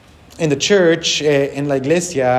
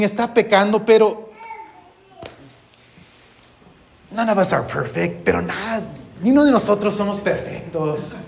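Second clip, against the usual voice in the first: higher mock excuse-making voice; higher, hammed-up churchgoer making excuses; imitated church members; 5 semitones higher than usual. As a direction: play the congregation brushing off sin. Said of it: "Perhaps you could hear them saying".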